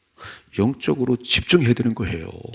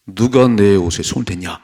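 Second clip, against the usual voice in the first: firm, raised-voice challenge; loud demanding question; 8 dB louder than usual. Acted. Jesus asking who touched his clothes, loud demand. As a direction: demand to know who did it.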